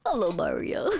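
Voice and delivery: low copy of a manly greeting. Retold or imitated. Copying a friend's manly voice, pitched low. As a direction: imitate the deep macho hello, amused. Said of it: "This is my manly voice".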